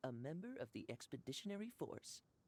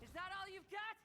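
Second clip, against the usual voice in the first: shouted taunt; 12 semitones higher than usual. Acted. Knight taunting ruin guard in battle.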